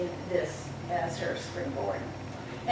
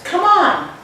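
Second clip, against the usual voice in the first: excited invite; 10 semitones higher, 11 dB louder than usual. Acted. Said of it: "laughing with delight".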